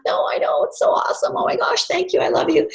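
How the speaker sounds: breathy, gushing whisper, high; gushing excitement. Retold or imitated. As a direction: squeal your thanks under your breath. Gushing thanks to delighted hostess.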